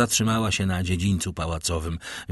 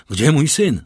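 loud, raised, urgent question; frantic demand; 5 semitones higher, 7 dB louder than usual. A narrator's reading. Father questioning servants about his missing son, frantic demand. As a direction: demand it of them, louder and higher.

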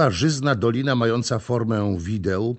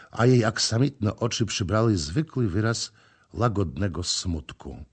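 ordinary delivery, nothing marked

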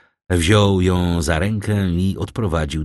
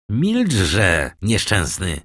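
plain reading voice

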